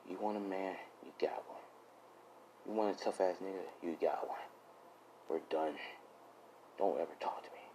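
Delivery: low, hushed, close-up threat; very soft and low, nearly whispering the insults; cold quiet menace. Acted. Deku to Uraraka as he brings her face closer, quiet menace.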